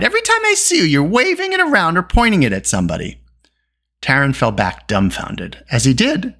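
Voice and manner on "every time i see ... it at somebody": put-on indignant girl telling someone off; indignant cry. A narrator's reading. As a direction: scold in a high, exasperated voice. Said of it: "Eilonwy cried".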